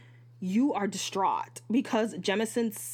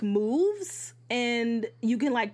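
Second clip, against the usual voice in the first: ordinary delivery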